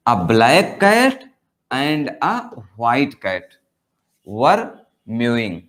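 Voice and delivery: very slow, word by word; slow dictation. Shown as a demonstration. Teacher reads the two cats example, slow. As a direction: read it out slowly like dictation.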